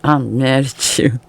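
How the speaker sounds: slow, drawn-out pretend anger; a sulky grudge that won't quite stick; slow mock sulk. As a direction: stretch it out like a stubborn pout. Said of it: "you're standing there like".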